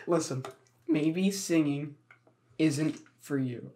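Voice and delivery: soft, slow, careful letdown; gentle letdown. Pretend. Telling hopeful Crystal singing isn't for her, soft and slow. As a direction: let her down gently and slowly. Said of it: "I'm like".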